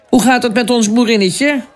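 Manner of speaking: high, jolly greeting; a hearty big guard calling out; cheerful gatekeeper. A narrator's reading. Big gatekeeper greeting the girl, jolly. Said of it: "goedlachse poortwachter".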